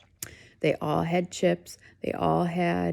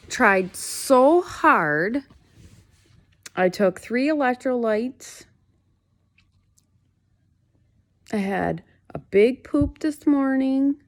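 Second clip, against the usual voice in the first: loud, high, drawn-out exasperation; worked-up complaint, voice pushed up and out; mad, venting; 6 semitones higher, 7 dB louder, much slower than usual. Real, not acted. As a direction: vent each effort slowly and emphatically. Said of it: "God, I'm so mad".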